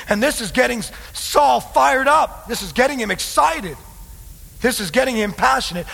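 high, impassioned sermon delivery; fired-up preaching. Real, not acted. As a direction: preach it with rising fervour. Preacher working up his intensity.

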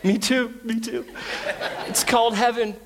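high, choked-up voice played for laughs; hammed-up tearful agreement; mock breaking down. Dad answering his daughter's wish, mock breaking down. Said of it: "like breaking down".

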